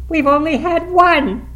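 high, loud, drawn-out insistence; feverish insistence. A narrator's reading. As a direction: press the point with frantic excitement. Hysterical wife insists on wishes.

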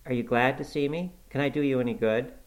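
ordinary delivery, nothing marked

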